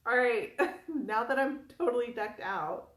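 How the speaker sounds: ordinary talk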